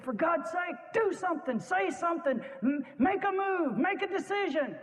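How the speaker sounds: high, pleading urgent cry; brother's desperate plea. Retold or imitated. Brother begging Chamberlain to act, high desperate plea. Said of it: "Chamberlain's brother said to him".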